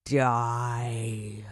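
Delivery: deliberate villain. A narrator's reading. Mister Motcher condemns the boy, slow and deliberate. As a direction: deliver it with cold finality. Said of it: "He spoke quite deliberately".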